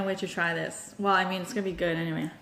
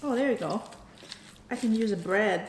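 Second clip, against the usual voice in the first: usual speaking voice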